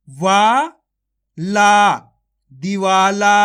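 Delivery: stretched-out syllable calling, each syllable punched; phonics drill. Shown as a demonstration. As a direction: sound out each syllable slowly for children, then join them. Sounding out syllables into words.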